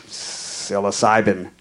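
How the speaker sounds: low, slow, soft aside; muttering to himself. Retold or imitated. Suspect saying to himself as the evidence comes out, quiet dismay. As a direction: say it under your breath, drawn out.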